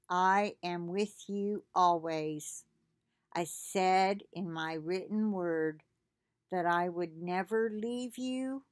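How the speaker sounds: low, slow and gentle, reading God's words to the listener; slow devotional reading. Acted. Reads devotional aloud.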